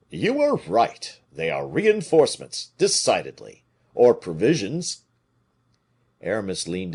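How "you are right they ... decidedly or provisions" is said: Porthos agreeing. A narrator's reading.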